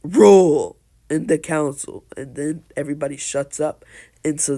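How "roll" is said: loud, raised one-word bellow; commanding shout. Retold or imitated. Lord Third silences the council with a loud shout. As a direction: shout it out like an order. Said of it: "And Lord Third says".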